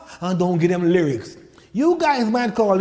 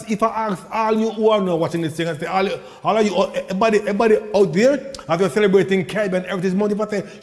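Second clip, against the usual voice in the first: ordinary talk, nothing marked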